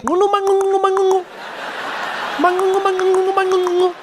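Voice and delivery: very high, chanting fake-language gibberish; mock movie African. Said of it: "those movies where they have Africans and they're like".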